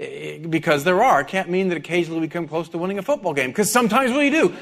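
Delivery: ordinary delivery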